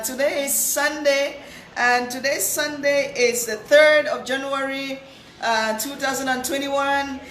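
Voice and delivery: ordinary talk, nothing marked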